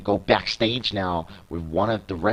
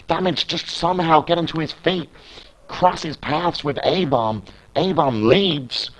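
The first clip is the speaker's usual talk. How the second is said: lebhafter Ringsprecher, der jede Aktion mitzählt; Play-by-Play-Kommentar; 5 semitones higher than usual. Acted. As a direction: kommentiere das Match live und treibend. Live wrestling play-by-play.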